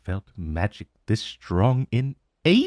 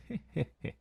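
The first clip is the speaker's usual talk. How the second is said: dragon chuckle; 9 semitones higher, 11 dB quieter than usual. Acted. Dragon amused by summoning.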